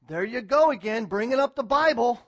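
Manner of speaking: loud, scornful jab at the preacher; mock objector. A critic complaining that the pastor brings up the Bible, mocking. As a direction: sneer it like a fed-up critic.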